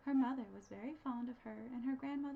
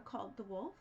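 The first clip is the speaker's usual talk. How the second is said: usual speaking voice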